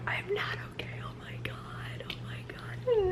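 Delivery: hushed, shaken whisper; real whisper. Real, not acted. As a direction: whisper it, overwhelmed by the ending. Reacting to book ending.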